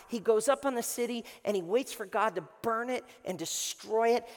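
normal talking voice

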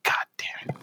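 retold curse under the breath; near-whispered, exasperated aside; hushed exasperation. Retelling a clueless young coworker moment, hushed exasperation.

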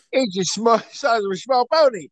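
high, exaggerated Cockney cab driver; thick hammed-up Cockney greeting; severe Cockney. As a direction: do an over-the-top chirpy London cabbie in Cockney. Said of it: "he was a severe Cockney".

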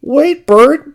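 high, loud urgent call; urgent high character voice; Ernie's voice. A narrator's reading. Ernie stops Bert.

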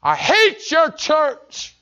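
put-on a drunk father's hateful roar; drunk father's rant. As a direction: spit out the hatred slowly. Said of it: "He said".

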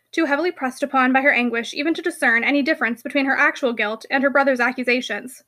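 plain reading voice

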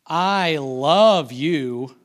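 drawn-out, heavy sarcastic "love you". Shown as a demonstration. Preacher demonstrating a bad tone toward his wife, sarcastic. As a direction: stretch each word slowly with mock devotion. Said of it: "say it like that".

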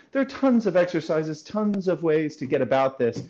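usual speaking voice

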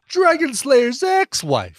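very slow, high mock-dramatic correction; hammed-up melodrama; melodramatic. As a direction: stretch it out like an overwrought actor. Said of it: "It's just so melodramatic early on".

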